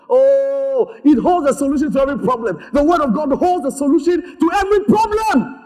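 high-pitched proclamation building to a shout; full-force preaching. Real, not acted.

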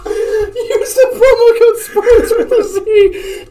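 high, slow, laughing through words. Real, not acted. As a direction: crack up while reading the code. Laughing through sponsor plug.

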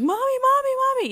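high-pitched imitation of a kid's wail; crying child calling mommy. Daughter crying out for her mom, high imitated child wail. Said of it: "She's, like, crying. She's like".

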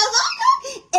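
very high laughing shriek; laughing squeal. Real, not acted. Laughing exclamation.

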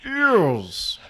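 drawn-out mock posh English reading; posh English accent. Shown as a demonstration. Co-host reading the assigned sentence, hammed-up posh English. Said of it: "this is the really posh English accent".

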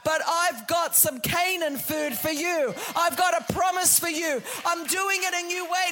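God announcing a new way, loud; voicing God's promise. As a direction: declare it like a promise. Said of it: "It's like".

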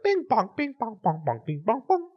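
put-on childish sing-song piano banging; imitated piano plinking. Imitating kids discovering the piano, high random plinking. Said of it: "they were just going, like".

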